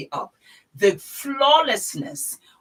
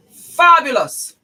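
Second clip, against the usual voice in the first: high, loud, slow fabulous; exclaimed fabulous; 9 semitones higher, 11 dB louder, slower than usual. Real, not acted. Vlogger thrilled by her tanned legs, high and loud. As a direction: cry it out in delight.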